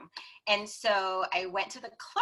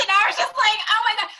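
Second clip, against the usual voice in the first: laughing; 12 semitones higher, 9 dB louder, much faster than usual. Real, not acted. Cracking up retelling.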